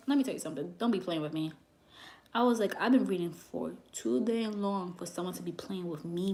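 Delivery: quiet, cold, don't-mess-with-me telling-off; low stern warning. Retold or imitated. Refusing the psychic's eight thousand dollar demand, low and stern. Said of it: "that's how I talk".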